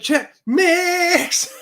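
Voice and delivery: high, loud, stretched last word; playful hailing yell; hollered catchphrase. Acted. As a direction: call it out with a long drawn finish. Mimics a viewer's signature greeting.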